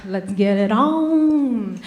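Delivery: slow, drawn-out come-on; slow mock seductive line. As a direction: purr it slowly like an invitation. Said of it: "I'm the one who's like".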